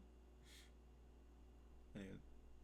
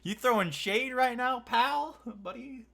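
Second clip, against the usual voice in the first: high, loud mock accusation; hammed-up teasing challenge; 11 semitones higher, 4 dB louder than usual. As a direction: tease him playfully, pitched up. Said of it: "I was like".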